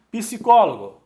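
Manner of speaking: "Psicólogo" said pi-si-có-lo-go, loud and high; emphatic "Psicólogo" opening with pi-si; extra vowel. Shown as a demonstration. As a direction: add an i between p and s in "Psicólogo". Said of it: "Observa que na pronúncia".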